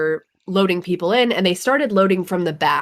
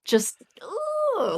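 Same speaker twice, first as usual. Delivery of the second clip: starstruck squeal; 5 dB quieter than usual. Retold or imitated.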